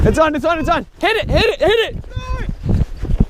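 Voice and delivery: high, loud, rapid-fire repeats; urgent excited shout. Real, not acted. Angler alerts partner that a fish is on, urgent shout. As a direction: call it out urgently, pitch climbing.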